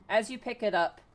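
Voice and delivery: slow, loud game master narration; dramatic scene setting. Acted. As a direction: announce the monster's arrival with drawn-out menace.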